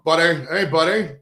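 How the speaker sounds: lightly put-on Canadian accent; mock Canadian accent. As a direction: imitate a Canadian greeting a pal. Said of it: "I love the way the Canadians call everybody buddy".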